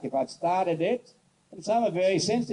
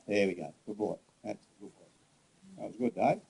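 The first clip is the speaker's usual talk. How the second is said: soothing praise; 7 semitones lower, 7 dB quieter than usual. Real, not acted. Trainer praising the horse that now stands still, soft and low. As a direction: lower and much softer, calming.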